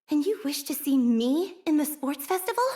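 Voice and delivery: can't believe they're asking; incredulous. Acted.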